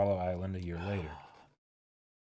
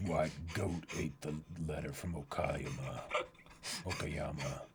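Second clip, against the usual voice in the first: goofy low reading; 7 semitones lower, slower than usual. Acted. Reading title in silly voice.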